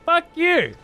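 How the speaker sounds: high mock dismissal, tossed off; defiant retort. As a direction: scoff back at the game's advice. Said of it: "I was like".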